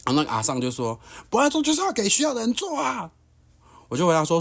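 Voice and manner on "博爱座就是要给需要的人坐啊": put-on much higher, a preachy older woman's voice; high mock auntie insisting on the rule; self-righteous auntie. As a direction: lecture him like a righteous old auntie. Auntie lectures about priority seats.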